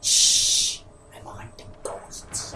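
slow hushed whisper of a hunter sneaking; Elmer Fudd stage whisper. Pretend. Friend parodying Elmer Fudd to the group in a slow whisper. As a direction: whisper it like a cartoon hunter stalking.

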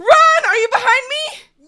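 high, loud frantic yell; frightened calling out; panicked shout. Real, not acted. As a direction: shout back while fleeing. Fleeing mobs, panicked.

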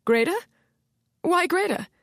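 jolted by the name; frightened witness hearing a friend named, alarmed; alarmed surprise. Acted. Ilona startled by the name.

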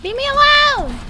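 high, loud yell at the attackers; panicked shout. Real, not acted. Player yelling at game enemies, real panicked shout. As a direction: scream it out in panic.

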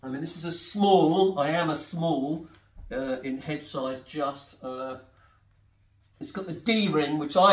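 muffled through helmet chin bar; talking while fastening helmet strap; speaking inside a full-face helmet. Real, not acted. Seen 0: Full-face helmet worn throughout; hands adjusting chin strap near mouth.

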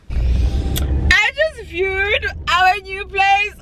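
high, slow, thrilled cry; excited shout. Real, not acted. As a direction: burst out with the good news. Excited news about new home.

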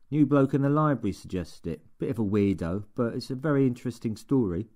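put-on a keen young lad explaining his book; Steve's voice. A narrator's reading. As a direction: play the eager, slightly dim assistant. Steve's voice in the reading.

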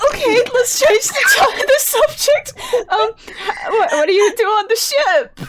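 high, laughing attempt to redirect; awkwardly giggling subject change. Changing subject amid the angel's panic.

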